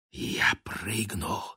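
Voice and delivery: breathy resolute whisper. A narrator's reading. Baguette whispering his resolve to jump. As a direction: whisper it to yourself, determined. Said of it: "прошептал Багет".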